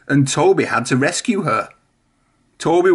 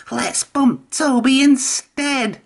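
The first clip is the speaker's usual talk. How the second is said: much higher, slow sly voice; whispering trucks; 11 semitones higher, slower than usual. A narrator's reading. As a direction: plot in secret. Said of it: "the trucks whispered to each other".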